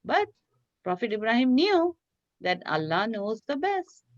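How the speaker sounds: usual speaking voice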